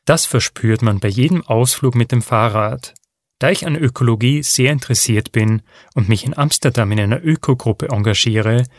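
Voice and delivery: ordinary talk, nothing marked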